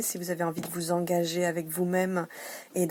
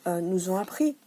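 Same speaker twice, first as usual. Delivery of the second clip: normal talking voice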